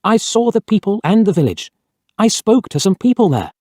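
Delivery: a touch of alarm, insisting to the garage man; cried out. A narrator's reading. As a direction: press the point in disbelief. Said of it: "I cried".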